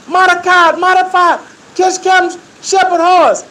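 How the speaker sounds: high, loud call across the field. Retold or imitated. Inmates yelling at Mordecai to catch the horse, loud call. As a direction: yell the name to get him moving.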